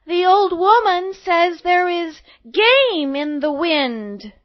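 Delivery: put-on high, loud, drawn-out girlish delivery; little girl announcing. A narrator's reading. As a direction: call the message out slowly as a little girl. Girl relays message to huntsman.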